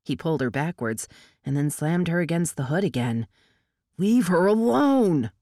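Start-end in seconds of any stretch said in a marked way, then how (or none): 4.0-5.3 loud, slow, high protest; shouting. Acted. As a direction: yell it out to stop him. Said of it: "Oil Can shouted".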